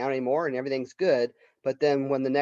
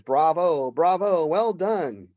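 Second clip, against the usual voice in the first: higher and louder, a mock cheer; old Chong's bravo; 5 semitones higher, 6 dB louder, much slower than usual. Deaf old neighbor cheering a terrible recital, loud mock bravo. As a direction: cheer it out like a hard-of-hearing old man applauding. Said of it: "So he says".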